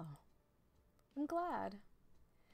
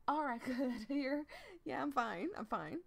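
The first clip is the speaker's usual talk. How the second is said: strained, louder words after a coughing fit; half-laughing, catching breath; recovering from coughing; 6 semitones higher, 5 dB louder than usual. Acted. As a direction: push through the last of the cough. Recovering after choking on tea.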